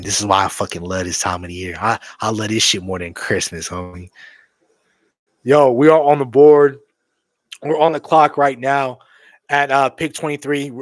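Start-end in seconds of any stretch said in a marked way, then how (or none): 5.4-10.7 loud, high, hyped call; excited announcement. Real, not acted. Announcing loudly that the Cowboys are on the clock. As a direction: announce it like the pick is in.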